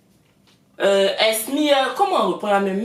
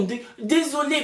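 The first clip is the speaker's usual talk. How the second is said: ordinary delivery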